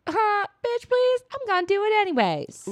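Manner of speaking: high, sassy defiant squeal; mocking god voice. Ole brushing off the rule, high and sassy. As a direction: toss it off like a cheeky rebel god. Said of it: "But Ole was like".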